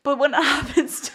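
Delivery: high, giggling through the words; real laughter breaking in; laughing. Real, not acted. Admitting self-cruelty while cracking up, high and giggly. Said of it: "I'm literally tearing up 'cause this is so funny".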